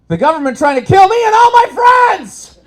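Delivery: high, loud indignant yell; angry shout. Acted. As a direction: blast it at him. Punchline outburst at the guy.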